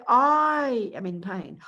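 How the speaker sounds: slow drawn-out "I am in pain"; mock complaint. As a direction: whine it slowly, a touch put on. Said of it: "we'll say".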